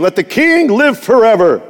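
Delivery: loud-ish, lifted royal salute; proclaiming tone. Acted. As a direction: proclaim it grandly to a king. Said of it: "that, that tone".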